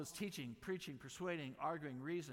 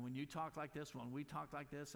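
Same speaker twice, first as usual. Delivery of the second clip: ordinary talk, nothing marked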